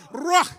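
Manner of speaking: Ruach loud, trilled R, rising; rolled R. Shown as a demonstration. Preacher repeating the rolled R for the crowd. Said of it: "roll that R".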